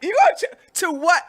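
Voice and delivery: high, loud disbelieving What; shocked at the price the shop quotes; incredulous outrage. Retold or imitated. Outraged customer disbelieving the charge at the shop.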